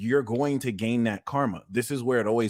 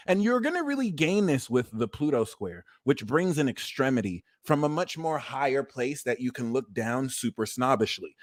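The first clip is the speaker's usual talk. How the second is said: usual speaking voice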